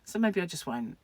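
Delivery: normal talking voice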